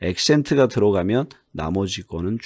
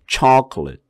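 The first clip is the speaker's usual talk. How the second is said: 초콜릿 with the first syllable louder and higher; stress on first syllable; 5 semitones higher, 6 dB louder than usual. Shown as a demonstration. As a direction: hit the first beat of 초콜릿. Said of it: "요거에 강조를 해요". (초콜릿 첫 음절 강조)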